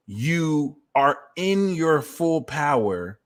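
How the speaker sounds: ordinary talk, nothing marked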